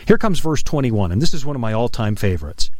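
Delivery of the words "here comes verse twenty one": usual speaking voice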